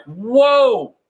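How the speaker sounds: high, drawn-out wonder; amazed. Acted. Amazed reaction acted in the shower.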